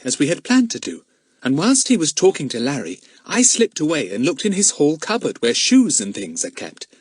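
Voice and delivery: put-on eager young detective recounting; self-important child. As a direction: report your find proudly. Plays Daisy explaining importantly.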